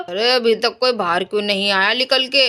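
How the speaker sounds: low-pitched muse to himself; scrap dealer, puzzled. Acted. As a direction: drop the sales call into a low wondering voice. कबाड़ी खुद से बोल रहा है. (कबाड़ी की नीची, हैरान आवाज़)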